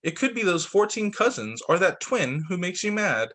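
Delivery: ordinary delivery, nothing marked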